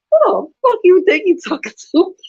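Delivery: high, loud retold outburst; a hammed-up furious yell; mock yell. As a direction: re-enact going off on the scammer. Retelling cussing out scammer.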